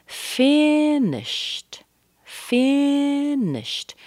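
"finished" stretched very high on FIN, crisp t at the end; careful "ed" ending. Shown as a demonstration. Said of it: "Be careful of the E D at the end".

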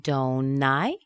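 "Don't I" rising steeply at the end; rising, linked tag. Shown as a demonstration. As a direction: link "Don't I", lift "I". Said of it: "Be careful of the T again".

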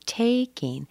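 "taking" with TAKE high, ING falling; stress on TAKE. Shown as a demonstration. Teacher shows the content word, stress on TAKE. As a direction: hit the first syllable of "taking". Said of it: "We stress the take".

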